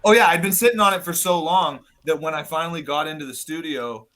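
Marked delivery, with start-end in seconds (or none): none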